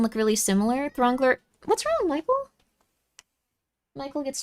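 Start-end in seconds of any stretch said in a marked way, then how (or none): 1.6-2.5 high cooing to a pet. Real, not acted. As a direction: coo at the cat. Talking to her cat.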